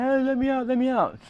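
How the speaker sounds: high, loud panicked shout; trapped kid yelling for help; yelling to be let out. Retold or imitated. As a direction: bang on the walls and yell to be freed. Trapped in bin, calling out.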